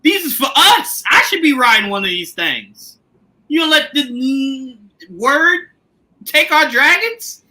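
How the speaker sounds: high, slow, laughing whine; a son whining to his mother; whiny son. Retold or imitated. Rhaenyra's son begging his mother, mock whine. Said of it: "He said".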